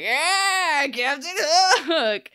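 loud, high, drawn-out pirate cheer; mock crew cheer. Crew hailing their new captain, mock cheering. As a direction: cheer like a whole crew, hammed up.

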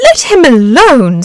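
loud, slow, firm command; fierce maternal warning; protective mother. Acted. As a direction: snap at the bully to protect her child. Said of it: "said his mother".

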